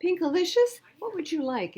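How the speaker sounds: high, loud, cheery shopkeeper; a bright, sing-song ice cream man greeting her; Mister Swizzle voice. Acted. Ice cream man greets girl, high and cheery.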